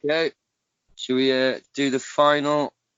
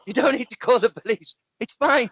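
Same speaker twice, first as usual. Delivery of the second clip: higher, hammed-up Cockney bloke saying mate; mock Cockney; 10 semitones higher than usual. Host acting out the machete man apologising to the victim, mock Cockney. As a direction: play a cheeky Cockney intruder apologising. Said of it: "I've gone all cockney".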